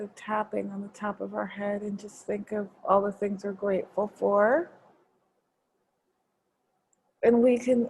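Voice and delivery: guided meditation. Acted. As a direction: gently guide them inward. Leads a gratitude meditation.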